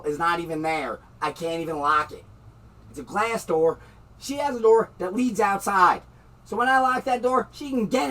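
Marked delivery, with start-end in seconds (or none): 4.2-8.1 angry shouting. Real, not acted. Furious rant about the door.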